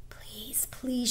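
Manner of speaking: breathy whispered pleading; silent plea. Acted. Girl silently begging, whispered. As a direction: beg under your breath. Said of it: "she implored in her mind".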